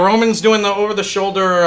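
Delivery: ordinary delivery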